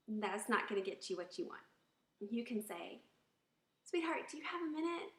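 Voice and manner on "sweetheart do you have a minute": high, quick, sweet request; pleasant tone. Shown as a demonstration. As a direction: ask the husband sweetly. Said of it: "when your tone of voice".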